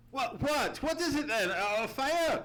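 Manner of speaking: jolted awake, voice jumping high; high, flustered cry of a man woken at dawn; startled surprise. Retold or imitated. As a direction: blurt it out half-asleep and alarmed. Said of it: "imagine my surprise".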